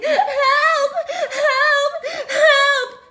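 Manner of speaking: high, loud mock cries of help; shrieking help, hammed up; high shout for help. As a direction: squeal for help like a fleeing girl. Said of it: "runs off shouting".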